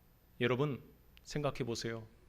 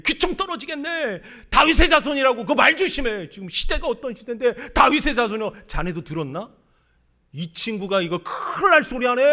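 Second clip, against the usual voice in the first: louder, higher rebuking villagers; scolding crowd; 9 semitones higher, 12 dB louder than usual. Acted. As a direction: snap at him to shut up. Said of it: "꾸짖었지요". (군중이 꾸짖는 목소리)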